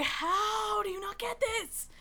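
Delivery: high, slow exasperated squeak; exasperated whine. Retold or imitated. Friend frustrated her reference was missed, high slow whine. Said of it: "And I was like".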